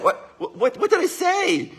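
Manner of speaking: high, drawn-out mock bewilderment; baffled protest. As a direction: act out a clueless husband protesting. Acting out clueless husband after hurting wife.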